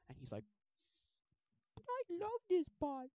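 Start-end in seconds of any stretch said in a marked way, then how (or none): 1.8-3.1 high, drawn-out mock whine; lovesick protest. Junie defending the bot, lovesick. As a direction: protest loudly in a squeaky voice.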